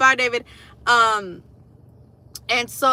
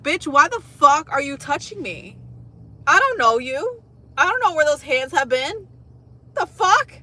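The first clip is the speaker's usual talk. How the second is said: louder, higher exasperated rant; indignant inner rant; 6 semitones higher, 5 dB louder than usual. Retold or imitated. Ranting silently at the stranger touching her, loud indignant. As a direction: let the outrage spill out.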